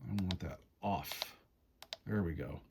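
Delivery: normal talking voice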